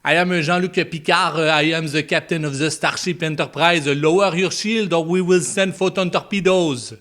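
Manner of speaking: mock Picard with a thick French accent; exaggerated French captain voice. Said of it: "can you imagine with a French accent".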